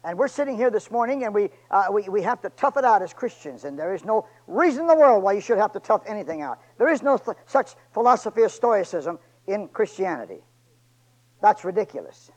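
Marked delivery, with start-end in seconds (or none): none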